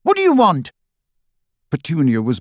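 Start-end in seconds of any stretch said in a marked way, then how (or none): 0.0-0.7 very high, loud angry shout; furious boy shouting. A narrator's reading. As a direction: bark it at the intruder. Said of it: "he shouted".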